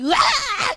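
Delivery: high, loud shout; mock yell. Psychiatrist showing husband how to yell in shower, loud shout. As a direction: let out a yell. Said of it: "lu teriak aja waktu lu mandi".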